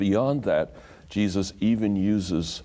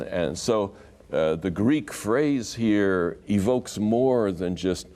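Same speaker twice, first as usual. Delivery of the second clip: ordinary talk, nothing marked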